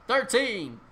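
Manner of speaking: loud announcement. Acted. Numbered absurd decrees, herald's loud call.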